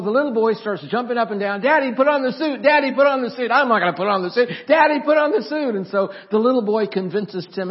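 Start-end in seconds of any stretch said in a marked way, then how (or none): 1.6-3.5 put-on high, loud, eager kid's chant; acting out a child jumping up and down; excited boy begging. 3.5-4.5 quick, loud, mock-stubborn refusal; acting out a father saying no; dad refusing. 4.7-5.7 high, loud insistent plea; acting out a kid who won't give up; excited boy begging.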